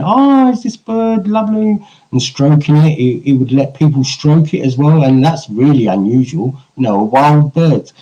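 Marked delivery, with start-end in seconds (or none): 0.0-1.8 high, admiring stranger; passer-by voice. Retold or imitated. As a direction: coo over the bird like a passer-by. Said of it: "and saying".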